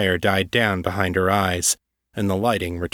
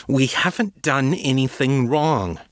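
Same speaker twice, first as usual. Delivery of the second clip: slow, fierce and raised insistence; fiercely; 5 semitones higher than usual. Acted. Sylvia defending herself to the agent, fierce and slow. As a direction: plant your feet and deny it hard. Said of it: "Sylvia said fiercely".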